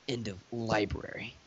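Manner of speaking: low, breathless whisper of the title; dramatic whisper. Acted.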